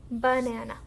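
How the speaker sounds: Banana joined up, still a little deliberate. Shown as a demonstration. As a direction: say Banana as one careful word. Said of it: "the faster you say it".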